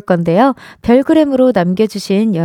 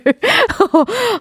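embarrassed high giggle; 12 semitones higher than usual. Real, not acted. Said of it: "우선 죄송합니다".

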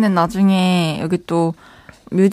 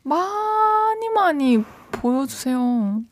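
playful drawn-out plea; 5 semitones higher, 5 dB quieter, much slower than usual. Real, not acted. Co-host begging him to show his talent, playful drawn-out plea. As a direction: stretch the first word long and high.

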